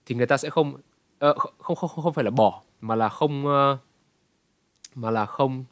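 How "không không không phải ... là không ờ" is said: ordinary delivery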